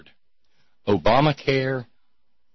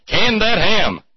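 much higher and louder, a hammed-up shout; mock exclamation; 12 semitones higher, 9 dB louder, slower than usual. Host cries out the punchline about Ken Ham, a hammed-up shout. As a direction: bellow the punchline for laughs.

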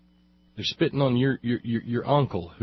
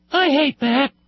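put-on a child's whiny complaint, much higher; little boy; 14 semitones higher, 3 dB louder than usual. Host retelling his childhood complaint in a high little-boy voice. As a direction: pipe up like a small boy who hates something. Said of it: "when I was a little boy, I'd say".

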